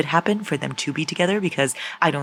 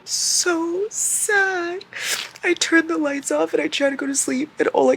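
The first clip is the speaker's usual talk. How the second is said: high whiny sadness; 12 semitones higher, slower than usual. Real, not acted. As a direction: whimper it high.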